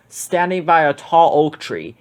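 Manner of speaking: plain reading voice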